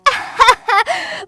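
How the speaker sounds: high, loud mock schoolkid laugh; giggling cackle. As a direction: cackle at a silly childish joke. Mocking the kids' joke.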